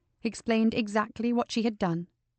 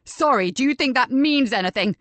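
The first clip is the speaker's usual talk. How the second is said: indignant shout, much louder; 4 semitones higher, 6 dB louder than usual. Acted. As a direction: throw the word back at her. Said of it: "Richard shouted".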